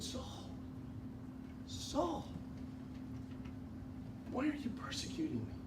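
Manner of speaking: soft, hushed and tender; tender soft near-whisper. Acted. The Lord calling to Saul in a tender whisper. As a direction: speak gently, almost whispering. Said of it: "this tender, soft voice, almost in a whisper".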